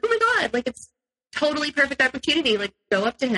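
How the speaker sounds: high, fast, eager encouragement; high excited nudge to go meet the comedian; excited urging. Retold or imitated. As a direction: push him on with bubbly excitement.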